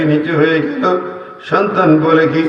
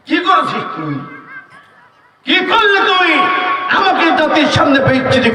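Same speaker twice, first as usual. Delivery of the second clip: high shouted rebuke; furious scolding; 9 semitones higher than usual. Acted. Mother shaming her son before the nation, shouting. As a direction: berate the son.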